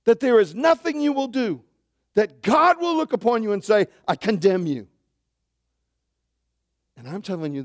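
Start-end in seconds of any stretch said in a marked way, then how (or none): 0.0-4.9 loud, high impassioned preaching; fiery sermon climax; preaching at full force. Acted. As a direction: drive it home from the pulpit.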